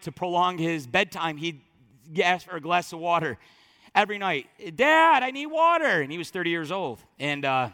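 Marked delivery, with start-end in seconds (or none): 4.8-6.0 put-on loud, high child's call; whiny call for dad. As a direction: yell it up the stairs like a kid. Son calling out for water.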